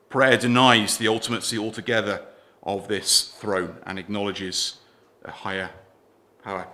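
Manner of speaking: ordinary talk, nothing marked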